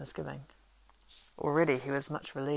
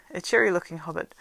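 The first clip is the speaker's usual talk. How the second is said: usual speaking voice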